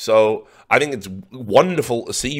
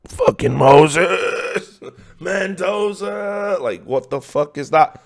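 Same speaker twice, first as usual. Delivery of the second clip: loud, long drawn-out cry, higher, laughing; exasperated outcry; 9 semitones higher, 4 dB louder, much slower than usual. Real, not acted. Cursing his colleague for jinxing it, drawn-out loud laughing outcry. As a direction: howl the names in disbelief.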